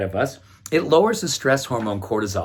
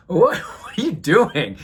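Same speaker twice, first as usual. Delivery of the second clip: puzzled onlooker; 4 semitones higher, slower than usual. Retold or imitated. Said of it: "that person's going".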